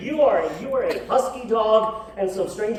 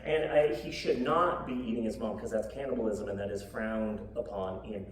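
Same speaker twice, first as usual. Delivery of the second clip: ordinary delivery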